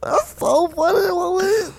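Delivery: very high crying voice; hammed-up high voice; tearful falsetto. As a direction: squeeze it out high and teary. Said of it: "how high did you get your voice?".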